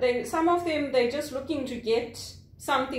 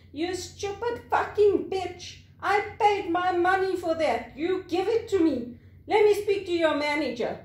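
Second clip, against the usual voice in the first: higher, indignant mock rant; angry North American customer; 5 semitones higher than usual. North American customer abusing call-center worker, acted angry demand. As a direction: imitate an irate customer making demands. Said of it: "That's how they talk".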